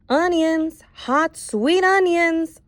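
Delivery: high, slow street vendor's call. Acted. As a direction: sing out your onions to the street. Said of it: "Sam called out on the street".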